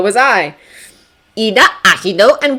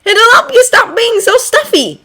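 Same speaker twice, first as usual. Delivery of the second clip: cheerful husband voice; 4 dB louder than usual. A narrator's reading. Hisashi's voice.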